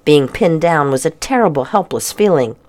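ordinary delivery, nothing marked

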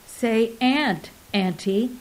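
usual speaking voice

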